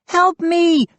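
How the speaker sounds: high loud plea; calling for help. A narrator's reading. Mindy dragged away, calling back for help. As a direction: scream for help while struggling. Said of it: "Mindy called back".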